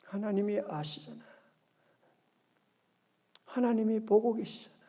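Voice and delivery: hushed, tender aside; soft, intimate word of trust; quiet reassurance. Acted. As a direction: drop right down and gently reassure. Voices quiet trust in God.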